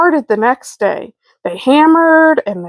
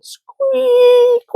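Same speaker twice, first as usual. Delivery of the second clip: squeaky door. A narrator's reading. The narrator mimicking the squeaking door, high and drawn out. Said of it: "went the door".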